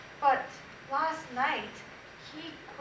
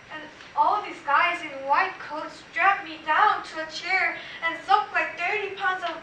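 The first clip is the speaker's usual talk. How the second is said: building from raised voice to loud, higher pitch; agitated dream retelling; 3 semitones higher, 6 dB louder than usual. Acted. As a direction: get more and more worked up as the nightmare escalates. Frantic retelling of nightmare, building.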